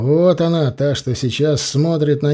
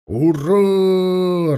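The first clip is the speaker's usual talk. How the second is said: roaring cheer; 5 semitones higher than usual. A narrator's reading. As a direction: roar it like a crowd of soldiers. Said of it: "раздался рёв гренадёров".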